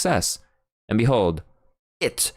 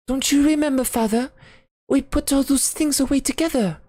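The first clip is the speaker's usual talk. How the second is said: put-on higher, innocent girl's voice; young daughter voice. A narrator's reading. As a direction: play the naive daughter gently reminding.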